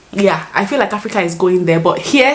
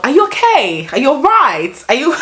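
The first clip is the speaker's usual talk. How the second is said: high, lilting imitation British accent; attempted British accent; 6 semitones higher than usual. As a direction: mimic a British person checking on someone, pitched up. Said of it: "I'm trying to use the accent".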